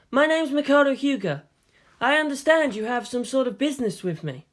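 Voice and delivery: lightly voiced polite young officer; formal young man. Makoto introduces himself.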